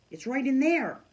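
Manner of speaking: high, eager realisation; a girl remembering she has a camera; excited. Acted. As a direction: blurt it out with new hope. Girl pointing to her backpack.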